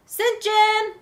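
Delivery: high-pitched mock maternal call; mom calling her son. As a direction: imitate a mother summoning her child. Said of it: "then the mom says".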